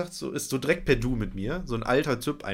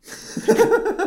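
bursts into a loud, high laugh; can't hold back the laughter; real burst of laughter; 8 dB louder than usual. Real, not acted.

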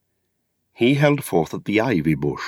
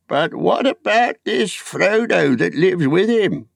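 put-on high, slow gossiping old hobbit; pry like a nosy village elder; high quavering old busybody; old Noakes; 11 semitones higher, slower than usual. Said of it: "asked old Noakes of Bywater".

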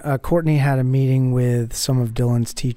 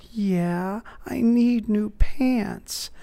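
mock little kid; 8 semitones higher, much slower than usual. Kid answers in retold story.

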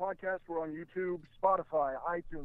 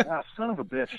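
laughing; 6 semitones higher than usual. Real, not acted. Laughing at bad connection.

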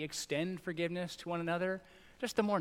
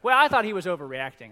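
put-on loud, cheeky kid voice; a child sniping at his dad; kid's remark; 3 semitones higher, 12 dB louder than usual. Kid telling his dad about a sibling, loud and cheeky. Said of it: "one of them was like".

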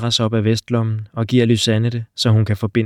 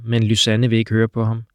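ordinary delivery

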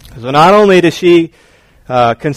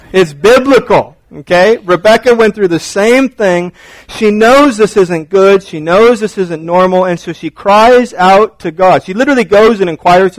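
usual speaking voice

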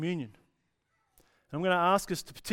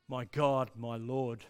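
lower, settled declaration; hopeful devotion; 4 semitones lower, slower than usual. Retold or imitated. The cry becomes a statement of promise, calmly devoted. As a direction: let the cry settle into trust. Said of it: "it actually becomes a statement of hope".

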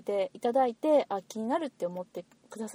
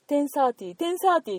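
higher, louder usher's call; 案内係の呼びかけ; 6 semitones higher, 5 dB louder than usual. Retold or imitated. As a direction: call it out twice like a theatre attendant. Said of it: "案内のお姉さんが". (案内のお姉さん風に高めの声で呼びかける)

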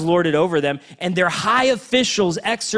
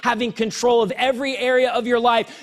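preaching at full force; 7 semitones higher than usual. Acted. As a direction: preach it out to the whole church, building.

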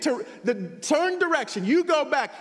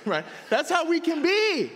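normal talking voice

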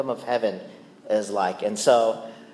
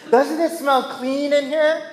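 much higher sing-song hinting voice; fishing for praise; 12 semitones higher, 4 dB louder than usual. Retold or imitated. Husband hinting to his wife about the clean kitchen, high and coy. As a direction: angle for a compliment coyly.

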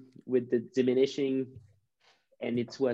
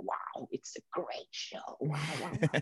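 put-on awed young fan; 2 semitones higher, 7 dB quieter than usual. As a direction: whisper your awe like a kid at his first show. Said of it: "So for me ... it was magic".

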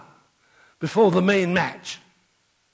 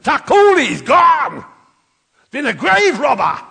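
alarmed cry; 5 semitones higher, 6 dB louder than usual. Acted. Women find tomb empty.

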